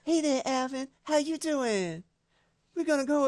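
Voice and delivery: high [name] impression. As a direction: mimic a high pop-star voice greeting. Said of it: "And he's like".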